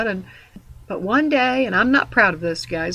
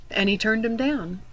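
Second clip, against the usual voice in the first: normal talking voice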